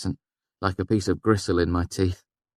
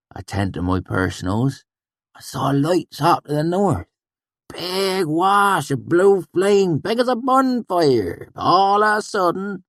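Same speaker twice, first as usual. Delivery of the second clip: high, slow telling of the blue flame; awed swineherd; 13 semitones higher, 5 dB louder, slower than usual. A narrator's reading. Sheem telling of blue fire, high and slow. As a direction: paint the flames big and strange.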